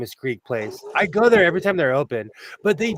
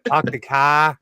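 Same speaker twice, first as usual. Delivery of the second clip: practice Boston accent, R-less car; slower than usual. Shown as a demonstration. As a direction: have a go at Boston vowels. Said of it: "do your Boston accent".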